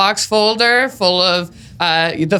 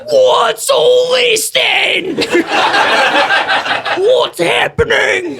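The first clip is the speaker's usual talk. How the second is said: mock British bobby; 11 semitones higher, much slower than usual. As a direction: boom like a startled English constable. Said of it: "You're like".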